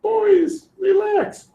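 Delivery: God shrugging it off to Moses; breezy reassurance. Retold or imitated. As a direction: wave off the worry lightly. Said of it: "His response is".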